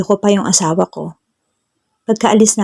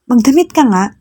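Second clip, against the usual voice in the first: mock shout; 5 semitones higher than usual. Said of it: "Sigaw ni Sarah".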